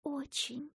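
soft whispered reply. A narrator's reading. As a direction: breathe the word timidly. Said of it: "шёпотом подтвердила Ася".

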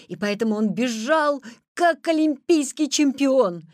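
ordinary delivery

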